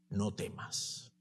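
whispered "No temas", slow and tender. Acted. Jesus comforting Magdalena at the tomb, whispered tenderly. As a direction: breathe it like a comforting promise. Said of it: "la dulzura con la que Jesucristo le debe haber dicho".

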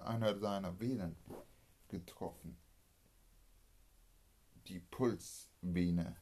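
ordinary delivery, nothing marked

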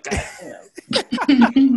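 high, loud startled exclamation; surprised. Retold or imitated. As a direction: blurt it out, caught off guard. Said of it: "I'm like".

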